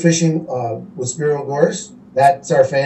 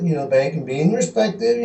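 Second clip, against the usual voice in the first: normal talking voice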